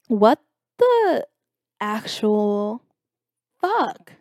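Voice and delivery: each word set apart, with long pauses; spaced-out, baffled reaction; stunned disbelief. Retold or imitated. Retold stunned reaction to the message.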